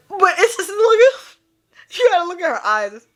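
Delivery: high, choked-up voice after laughing; crying with laughter. Real, not acted. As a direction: push words out past the giggles. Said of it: "I'm so crying".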